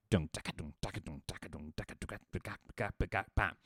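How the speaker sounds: soft dun taka rhythm, accents between the beats; beatboxed syncopation. Shown as a demonstration. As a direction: mouth the syncopated pattern softly. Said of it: "things are being emphasized like".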